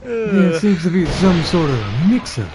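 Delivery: smooth it over grandly; Southern gentleman. Acted. Covering the joke in character, slow genteel voice.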